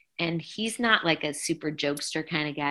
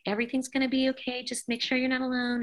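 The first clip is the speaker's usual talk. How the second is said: reassuring; 8 semitones higher, 4 dB quieter than usual. Retold or imitated. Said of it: "being like".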